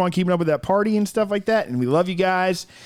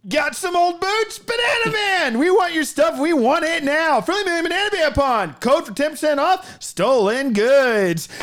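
cheesy ad voice; 11 semitones higher than usual. Acted. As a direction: shout it like a late-night pawn shop ad. Mock pawn shop ad read.

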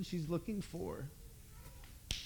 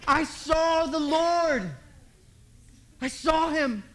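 loud, high, drawn-out proclamation; exultant shout; 7 semitones higher, 7 dB louder, slower than usual. Acted. Mary tells the disciples her news, shouting it exultantly. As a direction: cry it out full and long in triumph.

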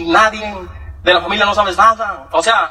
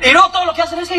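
usual speaking voice

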